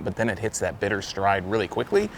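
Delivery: ordinary delivery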